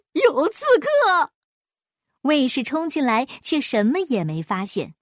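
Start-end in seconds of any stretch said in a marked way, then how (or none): 0.1-1.3 high, slow shriek of alarm; panicked cry. Acted. Frightened king cries out for his guards. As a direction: scream for the guards in terror. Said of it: "他就大惊失色".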